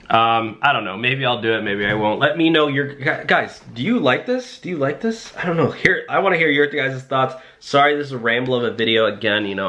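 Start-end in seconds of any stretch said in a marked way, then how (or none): none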